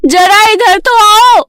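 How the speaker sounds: high, drawn-out call to a daughter. A narrator's reading. Father calls Payal from her room, calling out. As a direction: stretch the words slowly, calling her over. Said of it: "उसके पिता पायल को आवाज लगाते हैं".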